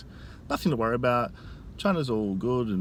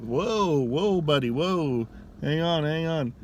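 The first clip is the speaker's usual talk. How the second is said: startled whoa; 6 semitones higher, 3 dB louder, much slower than usual. Retold or imitated. Startled reaction to the denial.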